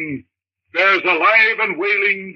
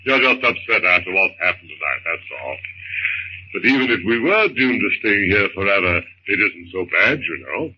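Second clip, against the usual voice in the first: calm his frightened wife in a deep voice; husband's voice; 12 semitones lower than usual. Acted. John reassures wife.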